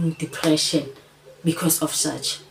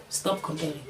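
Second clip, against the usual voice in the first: much softer, dropping away; softer repeat; 9 dB quieter than usual. Real, not acted. Repeating her plea to Sana, said much softer. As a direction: let it fall to a soft close.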